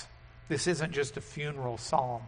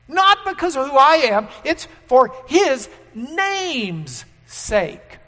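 rising to a loud, higher cry, then slowing; building sermon climax; 8 semitones higher, 9 dB louder than usual. Acted. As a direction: build up and hammer "Not".

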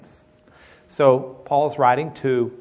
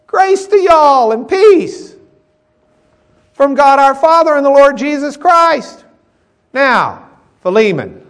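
loud, high preaching voice; full-force preaching; 11 semitones higher, 11 dB louder, slower than usual. Acted. Pastor preaching to his class on y'all, loud and high. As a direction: preach it out to the whole room.

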